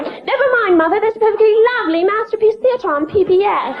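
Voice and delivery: prim mock-British little daughter; snotty British daughter. A British daughter declines her mother's American television, snootily. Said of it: "if I married a British guy".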